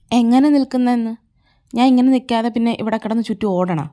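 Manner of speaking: loud, fast jealous comeback; angry retort. Acted. Jealous boyfriend snapping at his girlfriend, loud and fast. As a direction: snap back hotly, fast and loud.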